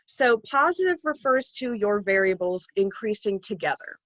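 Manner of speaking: usual speaking voice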